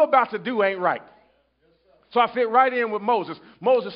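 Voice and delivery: fervent sermon cadence, loud and high; preaching. Acted. Preacher driving the point home to the church, fervent. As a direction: preach it to the congregation.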